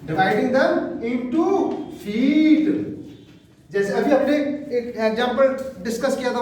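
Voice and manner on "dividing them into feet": slow dictation. Shown as a demonstration. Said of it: "teacher dictates slowly".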